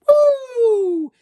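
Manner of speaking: loud, very high whooshing "woo"; long, shrieked sound effect, far above speech; high falling whoo. Retold or imitated. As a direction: imitate a drop through a trap door. God opens the trap door.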